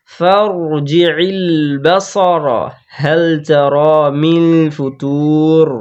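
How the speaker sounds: slow chanted tajweed recitation of the whole verse; Quran recitation. Shown as a demonstration. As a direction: recite it slowly with every long vowel held.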